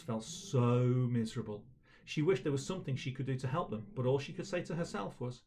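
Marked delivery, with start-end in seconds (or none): none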